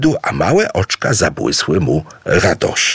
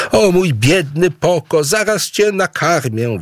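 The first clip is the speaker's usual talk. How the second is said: calling out; 10 semitones higher than usual. Acted. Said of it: "wołała Aboe".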